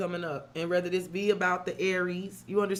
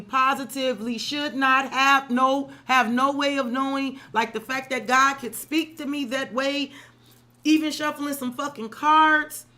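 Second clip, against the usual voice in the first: louder and higher, carried away by amazement; excited outburst; 5 semitones higher, 7 dB louder than usual. Real, not acted. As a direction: let the wonder burst out loud and high. Amazed at God's message.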